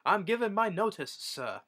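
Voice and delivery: high, prim servant voice dropping on sir; mock resignation. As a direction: deliver it like a prim butler quitting. Crew member quits, mocking.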